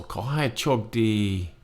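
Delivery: Ko hai chok di said slowly; slow demo. Shown as a demonstration. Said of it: "But you can also".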